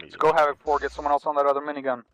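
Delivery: loud urgent order; shouting over engine noise. Real, not acted. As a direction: yell over the rotor noise.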